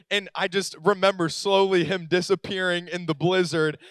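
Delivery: usual speaking voice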